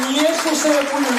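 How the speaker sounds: high, slow proclamation; defiant declaration. Retold or imitated. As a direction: proclaim it with full conviction. Repeats defiant answer, proclaimed.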